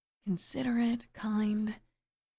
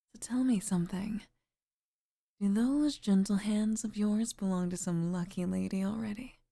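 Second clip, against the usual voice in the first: usual speaking voice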